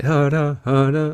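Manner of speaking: high, repeated two-note sample mimic; high mouthed vocal-sample echo; imitated vocal chops. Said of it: "vocal chops, like".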